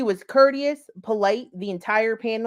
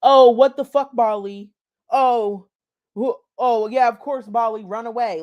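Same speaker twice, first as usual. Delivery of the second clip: loud, jeering mock outburst; hammed-up heckling of a guest leaving; mocking chat; 6 dB louder than usual. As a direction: jeer at her like the chat did. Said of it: "They were like".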